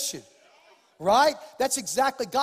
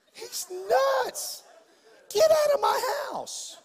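furious homeowner; 12 semitones higher, much slower than usual. Retold or imitated. Homeowner yelling at the pastor on his couch, outraged. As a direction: scream him out of your house.

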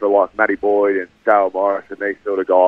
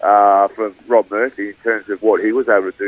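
ordinary talk

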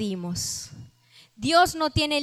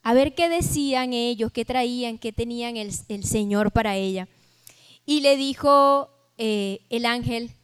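ordinary talk, nothing marked

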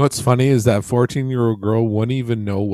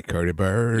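mock intro name. Host introduces the rant segment.

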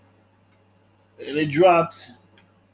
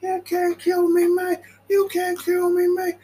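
much higher, fuller gangster yell from the balcony; yelling Tony Montana. Retold or imitated. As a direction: bellow defiance down at the attackers. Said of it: "He's up there yelling at the balcony".

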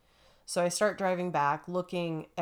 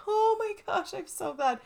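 much higher, relieved exclamation; 3 dB louder than usual. Retold or imitated. Hearing a stranger has her dog, a relieved gush.

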